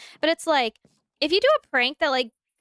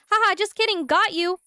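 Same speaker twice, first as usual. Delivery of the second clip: teasing gotcha; 6 semitones higher than usual. Retold or imitated. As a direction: sing out the prank reveal like a smug prankster. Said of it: "And you're like".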